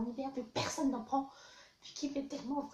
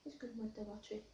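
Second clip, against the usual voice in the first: soft, low murmur; quiet apology; 4 semitones lower, 7 dB quieter, faster than usual. Pretend. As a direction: murmur sorry to the victim.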